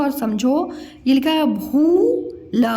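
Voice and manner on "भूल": भूल split, with a long rising भू, then ल; sounded out. Shown as a demonstration. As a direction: sound out भूल in two separate pieces. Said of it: "मैं अलग-अलग बताया करूँ". (भूल को अलग-अलग तोड़कर)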